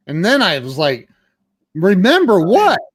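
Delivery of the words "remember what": louder, higher alarmed "Remember what?"; retold alarm at a forgotten promise; startled question.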